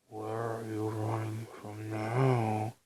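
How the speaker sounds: slow, small, eerie voice; a hint of a small haunting voice. A narrator's reading. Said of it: "a small voice asked".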